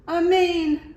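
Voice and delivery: high, loud delighted cry; excited cry. A narrator's reading. Iguana spotting the lion's mane, high excited cry. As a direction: gasp out the discovery. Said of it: "cried Iguana".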